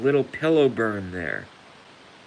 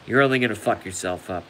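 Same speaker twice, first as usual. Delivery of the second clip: ordinary delivery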